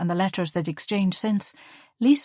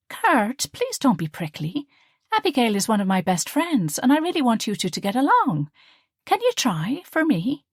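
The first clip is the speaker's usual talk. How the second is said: put-on pleading young woman; 6 semitones higher, 3 dB louder than usual. A narrator's reading. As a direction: coax him gently, raised pitch. Liesl pleading with Kurt.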